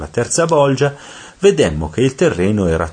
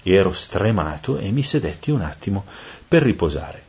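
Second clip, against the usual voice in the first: usual speaking voice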